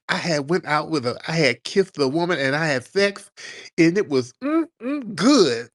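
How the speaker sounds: high, swooning, camp imitation; mock Andrew Caldwell. Mocking the delivered guy bragging about a woman, high and swooning. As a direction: lay it on thick with a dreamy mm. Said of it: "That sounds like him".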